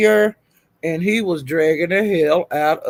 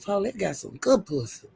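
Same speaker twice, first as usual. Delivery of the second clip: hushed; 10 dB quieter than usual. Real, not acted. Quietly repeating the note.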